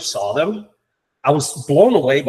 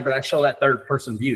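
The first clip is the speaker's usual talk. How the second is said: usual speaking voice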